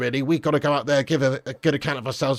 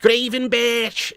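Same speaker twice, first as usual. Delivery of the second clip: high, drawn-out mock Scouse; Scouse accent; 6 semitones higher, much slower than usual. Streamer repeating a player's name for laughs, exaggerated Scouse accent. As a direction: stretch the name out in comic Scouse. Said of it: "Gravenberg in Scouse accent is really funny".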